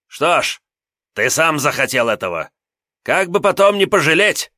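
high, loud menacing warning; angry threat. Acted. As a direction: warn him angrily, rising. Bully threatens before swinging. (злая угроза)

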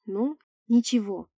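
usual speaking voice

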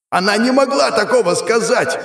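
high, forceful cry of denial; furious scream. A narrator's reading. Demon pinning the hero down, high furious scream. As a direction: scream it, refusing to believe. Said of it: "закричал демон".

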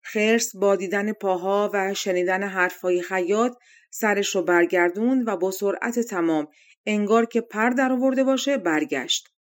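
usual speaking voice

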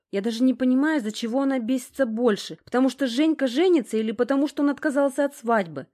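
put-on distraught young woman. A narrator's reading. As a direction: pour out a complaint as the fed-up girl. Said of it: "с таким злым отчаянием".

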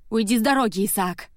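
loud, snapped order; sharp, angry command; irritable snap. A narrator's reading. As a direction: snap at someone blocking the way. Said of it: "огрызнулась я".

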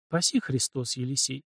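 soft, hushed thanks; barely audible. A narrator's reading. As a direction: murmur it under breath. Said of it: "еле слышно произнесла". (еле слышно)

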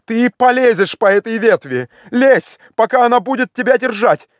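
high, pressing commands; wildly excited shout. A narrator's reading. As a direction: drive the climber on, beside yourself. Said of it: "закричал Легран вне себя от волнения".

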